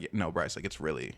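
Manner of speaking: low, matter-of-fact retold line; straight-faced. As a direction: imitate her saying it with no hint of flirting. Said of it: "with a straight face".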